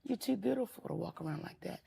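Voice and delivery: gentle auntie. Retold or imitated. Auntie softly calling a girl in a bonnet over. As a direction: coax her over kindly. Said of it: "I say".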